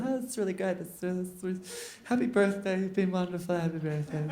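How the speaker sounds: soft, small-voiced birthday speeches; polite speech-makers. Guests giving lovely speeches at dinner, soft polite murmur. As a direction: murmur faint, halting tributes.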